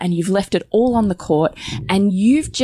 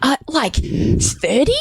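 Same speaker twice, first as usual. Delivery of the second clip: much higher exasperated mock rant; mocking husband's rant; 9 semitones higher than usual. Wife imitating husband's rant about celebrations, high and whiny. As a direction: whine it up high like an outraged husband. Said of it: "then he goes on a rant".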